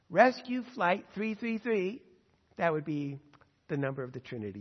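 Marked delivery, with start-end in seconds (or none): 0.1-2.0 slow, formal gate announcer; airport announcement. Shown as a demonstration. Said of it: "Here's the announcement, uh, at the airport".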